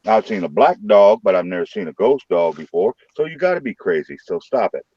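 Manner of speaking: ordinary delivery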